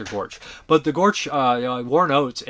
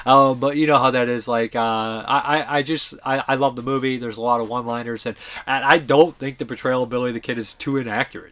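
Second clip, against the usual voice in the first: ordinary talk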